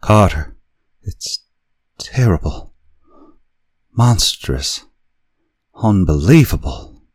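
high, loud, frenzied terror. A narrator's reading. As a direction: cry it out in panic. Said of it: "the frenzied tones again".

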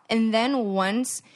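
usual speaking voice

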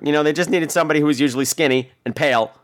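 ordinary delivery

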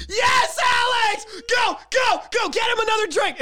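high, loud party cheer; cheering crowd. Retold or imitated. The bus crowd cheering Alex on as he pukes, loud high cheer. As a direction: shout it like the whole bus is egging you on. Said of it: "they're like".

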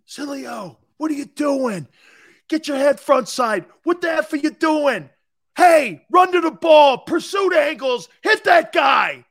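high, loud screaming from the sideline; Jimmy Johnson screaming at his linebacker in practice; coach screaming. Retold or imitated. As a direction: scream orders at your player. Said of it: "starts screaming at me".

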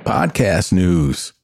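ordinary talk

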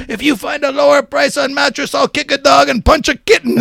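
high, loud parody ad voice; shouted spoof of a store promise; mock commercial pitch. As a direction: imitate an over-the-top mattress salesman.

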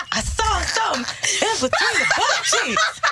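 laughing through a put-on man voice; a woman's mock man voice, cracking up. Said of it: "This is how he was talking".

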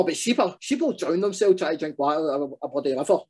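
usual speaking voice

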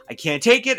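high, loud retold outburst; freaking out. Daisy freaking out at the boys, exasperated. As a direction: burst out, at the end of patience. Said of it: "And Daisy freaks".

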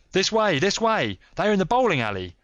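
high, loud rallying shout; urgent cry. A narrator's reading. Stranger darting through bushes to his companions, crying out. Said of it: "cried the stranger".

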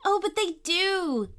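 put-on high-pitched child, eagerly insisting; insistent little girl. A narrator's reading. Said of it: "insisted Poppy".

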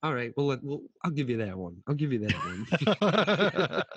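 grudging, laughing give-in; laughing concession. Retold or imitated. Conceding the basketball point with a laugh.

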